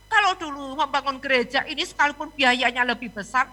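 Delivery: ordinary delivery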